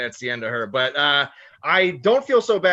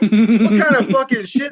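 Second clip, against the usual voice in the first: slow, loud, high laughing outrage; indignant laughing cry; 6 semitones higher, 9 dB louder than usual. Retold or imitated. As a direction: laugh out the question in disbelief.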